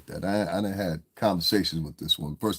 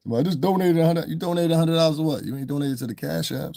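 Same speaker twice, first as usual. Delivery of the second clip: high-pitched, incredulous mockery; mocking challenge; 8 semitones higher than usual. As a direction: throw the claim back in disbelief. Mocks a donor, then challenges him.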